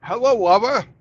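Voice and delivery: Hello, Wubba, loud and high with W for R; mock Japanese accent, loud. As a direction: imitate the businessmen mangling Roger. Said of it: "they would say".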